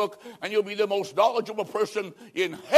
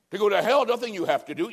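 ordinary talk

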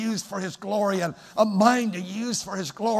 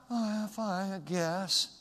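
softer, listless mumble; mopey reply; 3 semitones higher, 8 dB quieter, slower than usual. Retold or imitated. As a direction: sound half-hearted and glum. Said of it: "Get rid of that stuff".

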